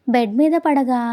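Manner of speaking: usual speaking voice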